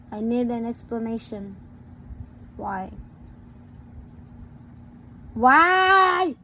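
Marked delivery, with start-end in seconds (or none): 2.6-2.9 low, soft demand for an answer; quiet questioning. Pretend. Parent asks child to explain the hit, quiet questioning. 5.4-6.3 loud, higher, stretched-out demand; exasperated demand. Pretend. Parent asks child again to explain the hit, exasperated demand. Questioning escalates after no answer.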